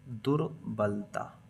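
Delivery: दुर्बलता drawn out slowly and softly; low-key, stretched-out दुर्बलता; slow dictation. Shown as a demonstration. Dictating while writing it down.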